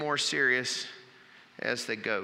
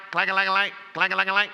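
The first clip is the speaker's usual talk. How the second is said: louder, higher metallic clank-a-lang noise; imitated clanking; 5 semitones higher, 6 dB louder, much faster than usual. Goat clattering on the car roof, imitated clanking noise.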